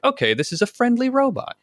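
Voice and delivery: high, quick cheerful mock; bright chirpy aside, quickly. Imitating listener's reaction.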